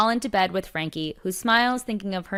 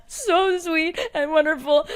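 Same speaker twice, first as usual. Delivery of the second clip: laughing, near tears; 8 semitones higher, slower than usual. Retold or imitated. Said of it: "my kids just being like".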